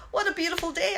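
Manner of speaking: cheerful exclamation with a lift at the end; joyful exclamation. Retold or imitated. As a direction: greet the day with delight. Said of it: "I'll go".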